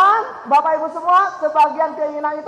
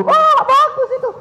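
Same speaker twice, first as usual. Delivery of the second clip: put-on high, laughing child glee; mock child delighted mum is embarrassed; gleeful laugh; 11 semitones higher than usual. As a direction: chuckle like a kid who's winning. Child's gleeful inner thought.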